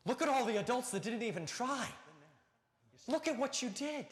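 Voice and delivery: acted amazement, pitched high; proud encouragement. Dad reassuring six-year-old daughter after she quit, high proud encouragement. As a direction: marvel at the child's feat, high and emphatic.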